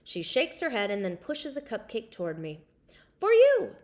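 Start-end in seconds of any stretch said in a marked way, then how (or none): 3.2-3.8 high, loud happy offer; bright offer. A narrator's reading. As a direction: hand it over with delight. Friend offering cupcake.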